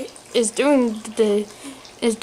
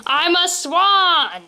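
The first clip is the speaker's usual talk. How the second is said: high, drawn-out mock yell; exasperated, stretched-out cry; 7 semitones higher, 3 dB louder, much slower than usual. A narrator's reading. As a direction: yell it slowly with rising indignation. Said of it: "Mister Boring yells".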